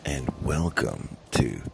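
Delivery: low, sultry host voice; sexy voice. Pretend. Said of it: "this is my sexy voice".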